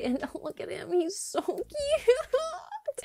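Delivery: high, drawn-out gushing coo; cooing over a cat. Real, not acted. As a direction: melt over the cute cat. Gushing over cute game cat.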